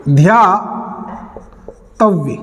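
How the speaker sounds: ध्यातव्य stretched out very slowly, syllable by syllable; teacher dictating ध्यातव्य very slowly; slow dictation. Shown as a demonstration. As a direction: drag ध्यातव्य out so students can write it. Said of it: "एक होता है ध्यातव्य".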